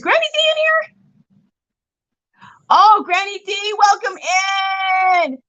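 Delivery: high, bright calling out to a friend; light up and call her name; excited call out. Real, not acted. Excited to see sick friend.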